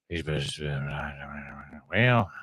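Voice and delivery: low, soft, mumbling actor impression; underplayed, halting movie-star mumble; Kevin Costner. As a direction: mumble it under your breath, barely acting. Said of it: "that's my Kevin Costner impression".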